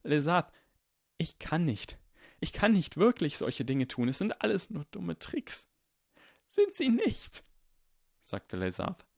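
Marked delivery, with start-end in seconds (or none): 0.0-5.7 high, strained helpless protest; breaking voice. Acted. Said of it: "mit berstender Stimme". 6.5-7.4 very high, desperate retort. Acted. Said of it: "seine Stimme hoch und verzweifelt".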